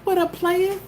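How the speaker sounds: high, laid-back cool-guy greeting; cooler player greeting. Pretend. Showing how the farmer should greet players, high and smooth. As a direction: say it like you just popped your collar. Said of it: "pop your collar".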